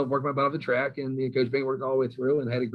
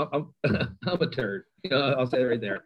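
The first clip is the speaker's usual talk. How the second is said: usual speaking voice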